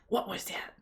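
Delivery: soft whispered trigger word. Retold or imitated. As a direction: whisper it to get the dog alert. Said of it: "but in whisper".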